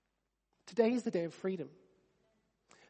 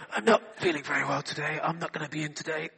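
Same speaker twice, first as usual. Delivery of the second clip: lower, weak and pitiful, with a laugh breaking through; fake sick voice; 6 semitones lower than usual. Worker phoning the boss to skive, mock sick voice. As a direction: play the poorly employee crying off work. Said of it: "you've got that sick voice".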